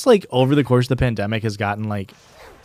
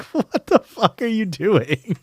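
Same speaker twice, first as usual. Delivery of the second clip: high, drawn-out question breaking into laughter; laughing disbelief; 10 semitones higher, much slower than usual. Retold or imitated. Appalled at his boss repeating it, laughing high-pitched disbelief. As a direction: stretch it out high through incredulous laughter.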